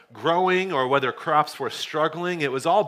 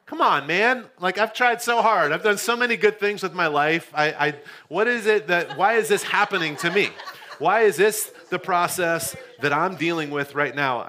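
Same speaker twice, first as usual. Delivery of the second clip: higher, louder mock plea of self-pity; frustrated complaint to God; 4 semitones higher, 3 dB louder than usual. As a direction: whine it out like a wronged man asking why. Pleading with God about the car.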